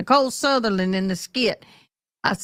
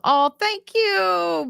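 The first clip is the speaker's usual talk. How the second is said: drawled thanks; 10 semitones higher, much slower than usual. Acted. Thanking a viewer comment.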